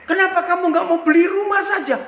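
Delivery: retold blurt, high, fast and loud; celetukan tiba-tiba. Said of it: "Tiba-tiba dari mulut saya itu nyeletuk". (nyeletuk tiba-tiba, cepat dan lantang)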